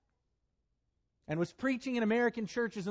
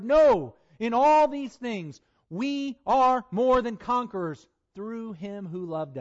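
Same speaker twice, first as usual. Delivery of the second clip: louder, slow, ringing declaration; triumphant proclamation; 4 dB louder, slower than usual. Acted. Preacher declaring victory to the congregation, loud and slow. Climax of scripture reading.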